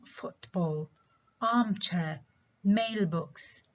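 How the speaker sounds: FOOTball, ARMchair, MAILbox, each said separately; first-component stress. Shown as a demonstration. Teacher demonstrating compound nouns, first-component stress. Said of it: "الستريس على الكومبوننت الأول".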